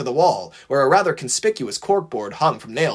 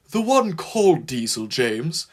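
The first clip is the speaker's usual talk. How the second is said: gently correcting a friend; tactful, careful correction; delicate correction; 4 semitones higher than usual. A narrator's reading. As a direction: correct him with care. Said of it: "corrected Henry delicately".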